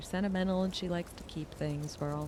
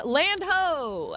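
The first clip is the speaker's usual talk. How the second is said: pirate lookout call; 10 semitones higher, 6 dB louder than usual. Pretend. Calling out pirate lookout cry.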